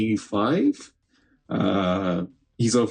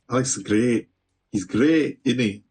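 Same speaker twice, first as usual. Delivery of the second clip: put-on Scottish accent. Streamer praises the featherweight champion in a mock Scottish accent. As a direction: slip into a not-very-good Scottish accent. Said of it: "I keep doing a Scottish accent".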